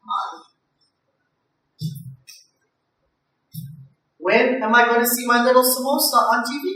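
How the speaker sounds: put-on high, thin old grandmother voice; grandma voice. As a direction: squeak it out like a frail granny with a walker. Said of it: "And she's like".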